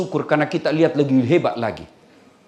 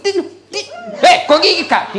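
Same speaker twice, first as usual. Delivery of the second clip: mock yelp; 13 semitones higher, 3 dB louder than usual. Baby biting, mother cries out.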